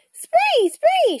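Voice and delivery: high, loud bird shriek; raven calling. A narrator's reading. Annie calling to Jack mid-flight, high raven cry. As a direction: call out in piercing caws. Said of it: "she called".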